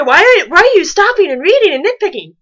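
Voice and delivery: mock an annoyed viewer's complaint; imagined listener. Reader imagining the audience's complaint, mildly mocking. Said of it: "you're probably gonna say".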